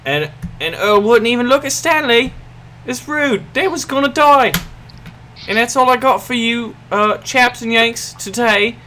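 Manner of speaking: put-on high-pitched hammy British accent; terrible British accent. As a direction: sign off in a bad, high British voice. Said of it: "sorry for my terrible accent".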